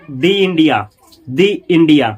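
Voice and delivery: "दि India" said as dee India. Shown as a demonstration. Teacher models the before India, pronounced dee. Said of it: "तो पढ़ेंगे दि".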